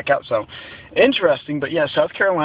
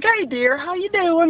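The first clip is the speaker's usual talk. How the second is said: put-on light Southern accent, pitched much higher; a playful take on the cashier's Southern lilt, high and friendly; imitating her Southern accent; slower than usual. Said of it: "she has a very familiar accent".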